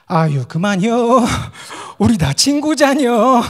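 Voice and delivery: slow, high, loud Chungcheong drawl; Chungcheong dialect. Retold or imitated. Chungcheong friend breaking up a fight, slow Chungcheong dialect. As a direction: plead slowly in a Chungcheong dialect. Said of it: "충청도 친구가 우리가 막 싸우면 그래요".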